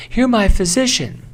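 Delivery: high voice playing a patient to their doctor; high acted patient line.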